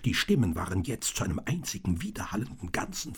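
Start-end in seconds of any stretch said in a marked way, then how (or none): none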